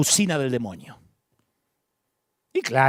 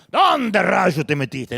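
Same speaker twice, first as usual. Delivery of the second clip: accusatory; 8 dB louder, much slower than usual. Pretend. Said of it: "no creo que sonó acusatorio".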